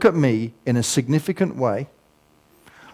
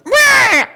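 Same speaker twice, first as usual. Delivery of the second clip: high squealing bagpipe wail, mock; a squashed bagpipe's dying squeal, much higher; 5 dB louder than usual. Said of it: "there's a noise that goes".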